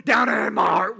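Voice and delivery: loud, deep, growly singing; a sour teacher belting a joy song. Retold or imitated. Teacher leads the Bible class song, deep and growly. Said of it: "she'd go".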